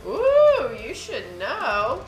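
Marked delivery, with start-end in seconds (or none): 0.1-0.8 teasing ooh. Real, not acted. Teasing reaction.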